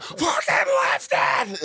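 very high, drawn-out shriek; shrieking high-pitched coaching yell; imitated scream. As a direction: scream it like a tiny wife at her strongman. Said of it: "they just scream at these men".

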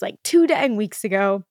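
ordinary talk, nothing marked

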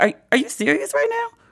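high disbelieving squeak; high-pitched incredulous mock voice; incredulous protester. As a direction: play it like you can't believe your eyes. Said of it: "They're just like".

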